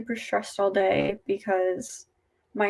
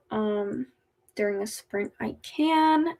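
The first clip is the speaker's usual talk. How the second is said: normal talking voice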